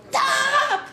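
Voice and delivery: high, loud mock cry to halt; imitated sudden yell. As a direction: yell it to stop a car. Actor halts a passing driver.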